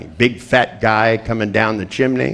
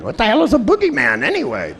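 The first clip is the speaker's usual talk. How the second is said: much higher, frightened what the hell; scared child's question. Retold or imitated. Frightened boy asking about the boogeyman, much higher. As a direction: ask like a spooked kid. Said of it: "I was going".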